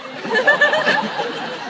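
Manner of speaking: a high, loud laugh breaking out; cracking up mid-speech; real burst of laughter. Real, not acted. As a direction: laugh at your own off-the-cuff joke. Laughs at own joke.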